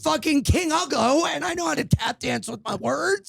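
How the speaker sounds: high-pitched mock-indignant boast; worked-up retold rant. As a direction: declare it with comic outrage. Retold rant, boasting self-deprecation.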